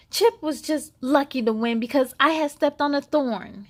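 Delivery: ordinary delivery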